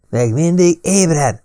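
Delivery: high, drawn-out screech. A narrator's reading. Drexler surprising two men at night, screeching. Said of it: "rikácsolta Drexler".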